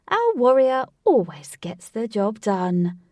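loud, slow, theatrical showman; eccentric showman voice. Acted. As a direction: ham it up like a game show host. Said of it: "in his eccentric voice".

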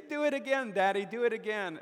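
a small daughter begging her dad for another throw; little girl's delight. Retold or imitated. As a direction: squeal it like a thrilled little daughter. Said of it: "and she would say".

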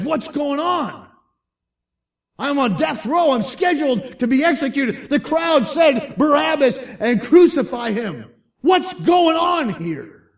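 loud, high, frantic questioning; bewildered outcry. Acted. Freed death-row prisoner demanding an explanation, bewildered outcry. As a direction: cry out in disbelief at full volume. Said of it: "you would probably verbalize".

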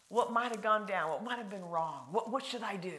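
normal talking voice

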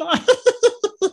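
Elmo giggle. Retold or imitated. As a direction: finish with a giddy little Muppet laugh. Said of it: "impression of ... Elmo".

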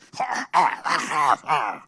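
villainous dinosaur cackle; big evil laugh. Acted. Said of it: "sounded like a big evil laugh".

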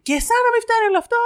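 very high, rapid outrage, acted out. Mother cursing the vendor's friend, furious retold rant. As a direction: climb into her indignant rant. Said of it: "με φωνή τώρα αυτά".

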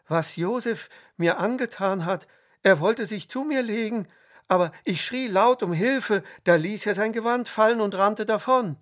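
high, loud, outraged accusation; crying out. A narrator's reading. Wife accuses Josef before the slaves, crying out. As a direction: accuse him in agitated indignation. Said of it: "rief die Frau".

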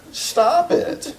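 high-pitched comic exasperation; exasperated command. Acted. Counselor cutting off client's confession, exasperated. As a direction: squeal the order at the client. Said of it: "he yells".

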